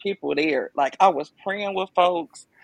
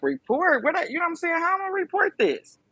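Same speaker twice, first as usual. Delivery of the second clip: shocked disbelief; 12 semitones higher than usual. Retold or imitated. Told to report, disbelief.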